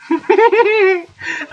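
high, near-crying laugh; frightened laughter. Real, not acted. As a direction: laugh helplessly between screams. Laughing between screams.

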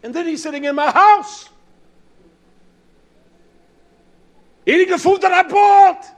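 very high, very loud indignant yell; hammed-up angry bellow; imitated outraged shout. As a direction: holler it in acted outrage. Acting out mentor's outraged complaint.

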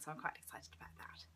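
secretive little whisper. Real, not acted. Vlogger teasing her next video to viewers, in a whisper. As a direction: whisper it like a teaser you can barely keep in.

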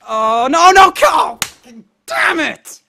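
anguished shout; despairing shout. Real, not acted. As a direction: beg the game not to fail you. Losing the jump.

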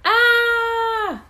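very high, loud frightened shriek. A narrator's reading. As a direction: shriek at the shark's mouth. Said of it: "Stanley shrieked".

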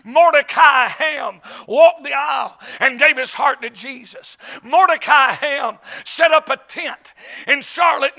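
punchy revival-preacher delivery; Southern revival storytelling, building to a shout; full-force preaching. Real, not acted. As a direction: preach it out to the tent, loud on the key words.

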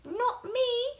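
playful high kitty denying it; cat voice. Acted. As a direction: mew it like a cat. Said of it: "meowed the cat".

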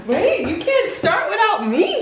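laughing protest, high and slow. Real, not acted. Child starts eating without her.

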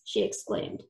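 usual speaking voice

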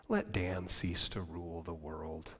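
slow, soft, low; hushed. Real, not acted. Preacher applying Luther's advice to himself, hushed. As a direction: say it gently to yourself.

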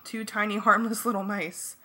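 ordinary delivery

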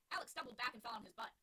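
ordinary talk

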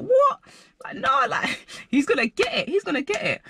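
hammed-up rage, threatening an unseen guy; imitating her high angry self; mock furious rant. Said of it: "but I was so angry".

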